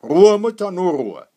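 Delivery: very loud, high-pitched call for order; commanding shout. A narrator's reading. Iron father silences his wife at table, shouting. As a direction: cut across the lament with full force.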